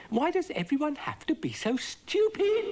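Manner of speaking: very high, loud exasperated cry; exasperated outburst. A narrator's reading. Dougal scolds his hopeless crew in a high, loud exasperated cry. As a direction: throw up your hands at the fools.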